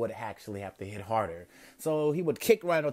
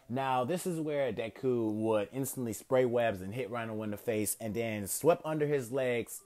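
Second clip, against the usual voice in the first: usual speaking voice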